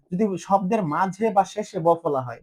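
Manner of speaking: ordinary talk, nothing marked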